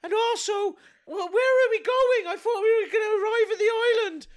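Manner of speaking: woman complaining to the hairy captain in a high falsetto; lady voice. Pretend. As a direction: play a flustered lady in falsetto. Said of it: "here comes a lady".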